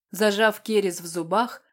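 plain reading voice

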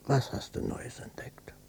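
whispered, suspicious question; hushed and uneasy; wary whisper. Acted. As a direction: ask under your breath, dreading the answer. Father presses again.

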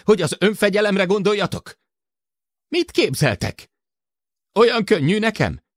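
high-pitched, heated rant; furious harangue at high pitch; shouted tirade. A narrator's reading. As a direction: berate them all, voice pushed high. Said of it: "ordította".

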